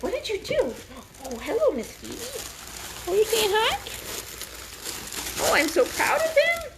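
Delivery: high cooing talk to a puppy; baby talk to puppies. Retold or imitated. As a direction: coo high like a proud puppy mom. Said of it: "We're just gonna be like".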